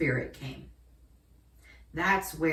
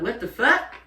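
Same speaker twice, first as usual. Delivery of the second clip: louder, higher startled blurt; comic, acted-out bewilderment; retold shock; 4 semitones higher, 7 dB louder than usual. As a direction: blurt it in mock disbelief. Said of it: "That was my first thought".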